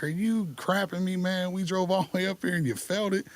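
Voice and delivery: high-pitched ribbing, imitating dad; retold amused disbelief, held high; mock-incredulous teasing. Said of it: "he's laughing at me".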